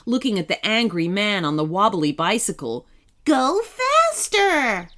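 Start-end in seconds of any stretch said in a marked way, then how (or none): 3.2-4.9 high, drawn-out command; urgent yell. A narrator's reading. Kenny to sloth driver during the chase, urgent yell. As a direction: urge the driver on, yelling. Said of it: "yelled Kenny".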